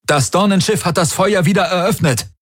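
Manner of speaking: high, alarmed warrior's report; urgent comm report. A narrator's reading. Warrior reporting to Daraios over the comm, high and alarmed. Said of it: "meldete einer seiner Krieger".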